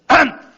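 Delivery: loud, exaggerated throat clear; sharp, high hammed-up "ahem"; mock throat-clearing. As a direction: act out clearing sleep from the voice. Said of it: "전화 받기 전에 목소리를 세 번".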